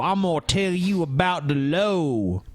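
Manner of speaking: Southern drawl impression. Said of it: "the way he talks is".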